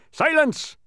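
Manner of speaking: very high, loud one-word shout; thundering command. A narrator's reading. Policeman silencing the terrified humbug, thundering command. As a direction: thunder it to cut him off. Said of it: "thundered the policeman".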